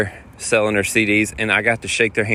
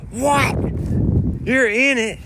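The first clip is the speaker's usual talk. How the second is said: much higher, astonished question; stunned disbelief; 12 semitones higher, slower than usual. Retold or imitated. The family learning he plays in the [name] show, astonished and higher. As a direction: react like you can't believe it.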